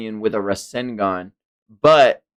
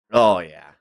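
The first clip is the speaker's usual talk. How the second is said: usual speaking voice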